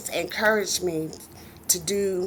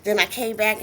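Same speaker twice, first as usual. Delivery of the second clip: usual speaking voice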